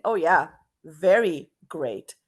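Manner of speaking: slow, loud mock agreement with a pause; sarcastic. Shown as a demonstration. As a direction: draw out VERY and let GREAT drop ironically. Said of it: "say it with a tone of voice".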